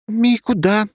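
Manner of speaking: high, loud, angry squeak. A narrator's reading. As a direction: squeak it out, cross. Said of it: "пискнула сердитая мимика".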